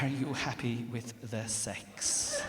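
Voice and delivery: slow, laughing repeat; comic slow repeat of the question; slow, drawn-out repeat. Retold or imitated. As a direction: stretch it out to let it land. Repeated slowly for effect.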